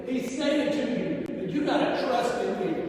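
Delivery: ordinary talk, nothing marked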